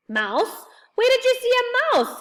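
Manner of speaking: high, loud alarm. A narrator's reading. Old man who misheard about a mouse, alarmed and high. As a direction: question rising into a high yelp. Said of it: "said the old man, alarmed".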